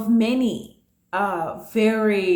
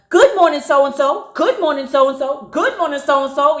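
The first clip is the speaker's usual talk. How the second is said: high, loud sing-song greeting; sarcastic; 5 semitones higher, 5 dB louder than usual. Retold or imitated. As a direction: pile on fake cheer, greeting after greeting. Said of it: "you know, sarcastically".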